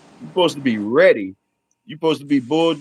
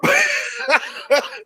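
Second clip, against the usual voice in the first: sudden squealing laugh; laughing hard; high burst of laughter. Real, not acted. Laughing at own joke.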